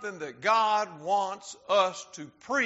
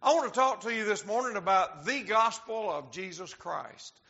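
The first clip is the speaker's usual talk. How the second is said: normal talking voice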